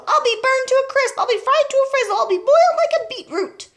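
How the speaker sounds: high, loud panicked complaint; frantic yell. A narrator's reading. Burning grandma fearing she will cook, frantic yell. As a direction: wail each line loudly, pitched high. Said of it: "she yelled".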